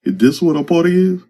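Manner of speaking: high, incredulous little voice; high-pitched question. A narrator's reading. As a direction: ask it in a high mock voice. Eyeball's mock question, pitched high.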